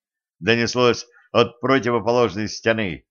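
ordinary delivery

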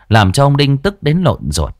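ordinary delivery, nothing marked

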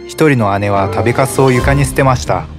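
ordinary delivery, nothing marked

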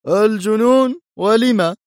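loud, high surprised question; astonished cry. A narrator's reading. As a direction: exclaim in astonishment. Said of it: "في دهشة وصاح".

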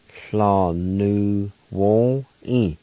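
Fla nu won e drawn out very slowly; slow teaching take of Fla nu won e; very slow pronunciation. Shown as a demonstration. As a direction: stretch Fla nu won e for learners.

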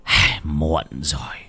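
low, slow, resigned sigh of a verdict; lightly voiced grave old master. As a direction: sigh out the bad news slowly. Thầy Kha's grave verdict.

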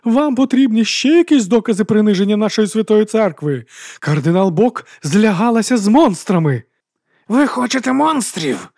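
high, loud, furious harangue; thundering. A narrator's reading. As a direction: rouse the crowd with outrage. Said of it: "гриміла вона".

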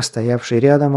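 ordinary delivery, nothing marked

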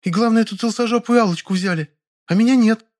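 high voice full of hurt; offended high-pitched female voice; deeply offended. A narrator's reading. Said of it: "с нешуточной обидой в голосе".

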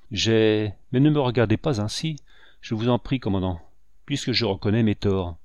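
ordinary delivery, nothing marked

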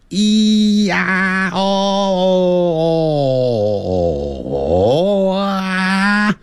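"咿呀哦哦哦啊" chained in one long, high held tone; sustained vowel demo. Shown as a demonstration. Teacher showing what vowels are, long high connected vowel sounds. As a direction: glide slowly through "咿呀哦哦哦啊", voice held high. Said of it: "这都叫做元音".

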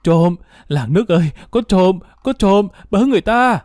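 high-pitched alarm cry, climbing as it goes; narrator's acted scream, held in volume; screaming for help. A narrator's reading. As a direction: call the neighbours for help, pitch rising. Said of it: "bà mới hét lên".

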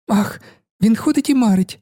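high, playful cry; merry exclamation. A narrator's reading. Camilla teasing the distracted poet, merry and high. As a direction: exclaim it gaily, pitched well up. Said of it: "вигукнула вона весело".

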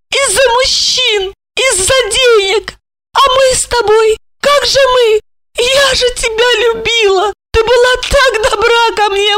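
high, loud, tearful pleading; plaintive. A narrator's reading. Olga begs Marina for her life, high plaintive pleading. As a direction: beg her sister, high and hurt. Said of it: "Голос её стал жалобным".

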